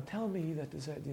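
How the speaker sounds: high, soft mock recitation; softly imitating the wrong tone; unchanged voice, not challenging. Shown as a demonstration. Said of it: "So he's not going".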